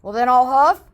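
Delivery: loud booming threat; wolf's threat. Acted. Wolf threatening the pig, loud and bold. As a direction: bellow it at the house. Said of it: "cried the wolf".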